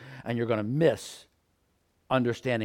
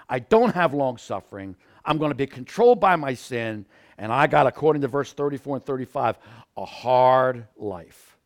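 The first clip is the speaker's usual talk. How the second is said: louder, emphatic sermon delivery; loud preaching; 6 dB louder than usual. Acted. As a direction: press each phrase hard.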